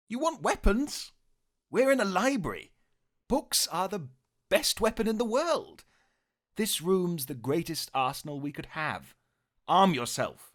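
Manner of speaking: high, excited Doctor Who voice; rapid, eager Tenth Doctor; Tenth Doctor impression. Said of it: "It's David Tennant as the Tenth Doctor".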